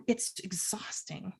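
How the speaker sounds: drops to a soft, slow whisper; tired whisper of exhaustion. Real, not acted. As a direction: let the word exhausting fade to a whisper. Admitting exhaustion mid-sentence.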